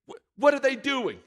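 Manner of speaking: high, sneering mock question. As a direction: scoff at the builders. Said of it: "he was mocking them".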